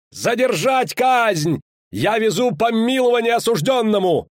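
high, slow, loud proclamation; shout. Acted. Rider announcing a pardon to the crowd, slow high shout. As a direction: call across the square.

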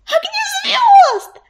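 very high, drawn-out squeal; joyful squeal. A narrator's reading. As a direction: shriek the name, stretched out high. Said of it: "завизжала Белочка". (радостный протяжный визг)

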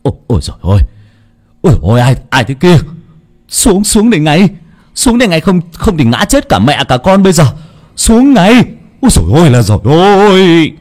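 high, loud frightened calling; panicked shout. A narrator's reading. Dụng shouting at the ghost woman in the tree, panicked and loud. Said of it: "mới kêu lên".

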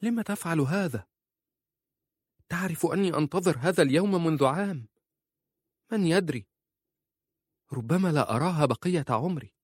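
high, confused protest; uncomprehending wife. A narrator's reading. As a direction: plead high-pitched, unable to understand. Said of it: "قالت في عدم فهم".

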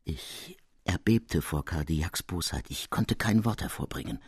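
usual speaking voice

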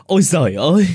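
high, loud cry of dismay; shrieking cry. Acted. Rich lady shrieking at the old woman's plight. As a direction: cry out in horror. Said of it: "Bà bá hộ thét lên".

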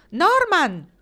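high, loud call across distance. A narrator's reading. Marnie shouting to Norman from afar, loud call. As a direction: shout his name over the crowd. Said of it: "La sua voce si alzò di nuovo".

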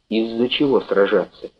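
plain reading voice

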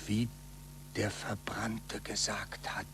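slow, whispered; hushed and afraid; fearful whisper. Acted. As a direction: whisper the forbidden name. Fearful mention of burned heretic.

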